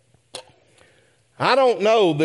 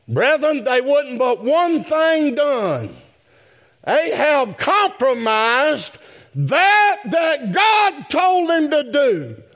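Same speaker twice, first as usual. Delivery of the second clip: rising to a shout at the congregation; preaching at full force; 7 semitones higher, slower than usual. Acted. Preacher to congregation, shouting the climax about Ahab's compromise.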